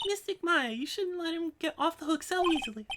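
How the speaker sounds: put-on high scolding little girl; young girl voice.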